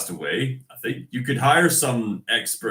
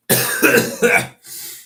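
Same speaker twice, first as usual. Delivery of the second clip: loud dry cough from a cold; coughing fit; 7 dB louder than usual. Real, not acted. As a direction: cough it out, clearly sick. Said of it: "I'm recovering from a cold".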